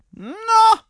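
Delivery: very high, loud exclamation; brash rider. A narrator's reading. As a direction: kick off boldly at full voice. Rider ready to fight. (hoher, kräftiger Ausruf)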